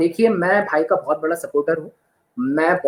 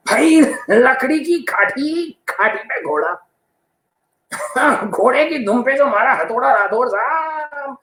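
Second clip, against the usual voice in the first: slow, drawn-out recital with laughs; a veteran actor hamming the rhyme between chuckles; Naseer impression; 6 semitones higher, slower than usual. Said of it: "Naseer साहब आके बोलते हैं कि".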